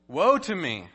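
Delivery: loud, high woe cry; anguished cry. Retold or imitated. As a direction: cry it out in dismay. Isaiah's cry quoted.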